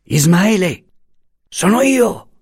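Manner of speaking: calling out high, half-voiced. A narrator's reading. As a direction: call his name up into the dark. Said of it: "chiamò a mezza voce".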